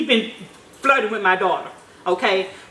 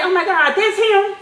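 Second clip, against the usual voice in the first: excited recognition; 8 semitones higher than usual. Retold or imitated. Mom recognizes the boy.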